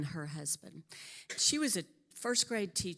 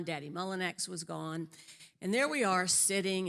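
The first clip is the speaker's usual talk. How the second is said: ordinary talk, nothing marked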